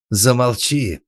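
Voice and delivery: outraged high outburst; shriek. A narrator's reading. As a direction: shriek him into silence. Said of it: "взвизгнула сеньора Тереза".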